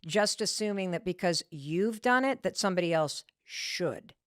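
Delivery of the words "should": "should" set apart and weighted; pause, then land firmly on "should"; a slow, falling, emphatic "should"; stress on SHOULD. Real, not acted. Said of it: "I'm emphasizing the word should".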